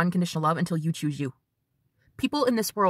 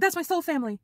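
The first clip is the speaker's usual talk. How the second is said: excited neighbor; 9 semitones higher than usual. Retold or imitated. Acting out excited neighbor at UFOs.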